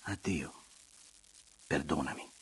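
soft, low, sweet goodbye; tender, hushed apology; gentle farewell. A narrator's reading. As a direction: answer gently, asking forgiveness. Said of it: "Elena rispose dolcemente".

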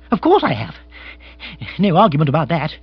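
flustered aristocrat hunting for words; agitated lord, indignant. Acted. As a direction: bluster quickly, then falter.

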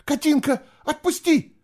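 very high, loud frightened plea; panicked. A narrator's reading. Girl pleading with the drowned sister gripping her, panicked. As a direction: beg loudly to be released. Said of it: "выкрикнула она в панике".

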